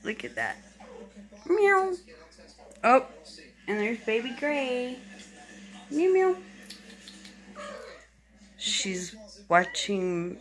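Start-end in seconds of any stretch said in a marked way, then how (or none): 1.5-1.9 high squeaky meow at the kittens; imitating a kitten's meow; cat meow. Meowing at kittens. 5.9-6.3 cat meows. Retold or imitated. Meowing at kittens.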